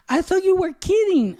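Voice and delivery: high-pitched, protesting wife voice; imitated wife. Wife explains her mistake to husband, high mock protest. Wife's surprised reply in retold quarrel.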